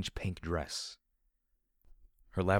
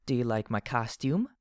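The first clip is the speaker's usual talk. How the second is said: put-on higher, childlike creature voice; creepy filly; 4 semitones higher than usual. A narrator's reading. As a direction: ask it like an eerie little girl foal. Creepy filly robot speaks.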